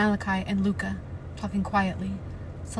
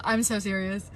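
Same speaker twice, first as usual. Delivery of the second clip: usual speaking voice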